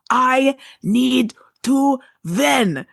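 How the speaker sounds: slow, high imitated Russian accent. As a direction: stretch each word out in a Russian accent. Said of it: "like, with the accent".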